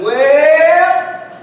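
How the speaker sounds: hugely drawn-out, rising "well"; long-winded lead-in. Retold or imitated. Alvin starting his forty-five minute answer, mock long-winded. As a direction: stretch it out loudly as if settling into a long story.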